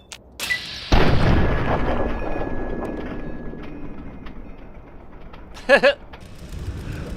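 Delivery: long, high, breathless wheezing laugh. Real, not acted. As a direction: lose it laughing. Long laughing fit at game.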